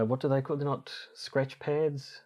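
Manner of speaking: normal talking voice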